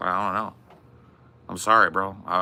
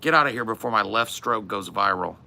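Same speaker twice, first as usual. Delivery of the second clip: put-on loud, higher dismissive old head; mock grumpy veteran telling off a fan; gruff old-timer shooing a kid; 3 semitones higher, 5 dB louder than usual. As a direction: shoo the kid off with swagger. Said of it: "I'm like".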